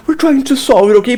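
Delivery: high-pitched servile pleading; mock grovelling. Officer placating the man bribing him, high mock begging. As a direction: whine it like a desperate lackey. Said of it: "Instead, he's like".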